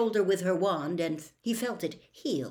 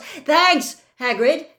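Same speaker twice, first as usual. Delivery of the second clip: high, loud interruption; raised voice, pointedly cutting someone off; loudly; 9 semitones higher, 10 dB louder, much slower than usual. A narrator's reading. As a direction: cut in loudly to stop him talking. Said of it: "said Harry loudly".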